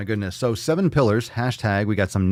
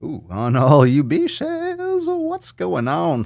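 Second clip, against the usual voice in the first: slow drawn-out greeting; 7 semitones higher, 4 dB louder, much slower than usual. Acted.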